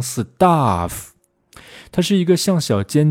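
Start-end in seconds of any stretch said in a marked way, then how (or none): 0.0-1.1 "stuff" as a drawn "si-taaf"; "stuff" with a long ah and split s-t; wrong open vowel. Shown as a demonstration. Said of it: "不要读成stuff".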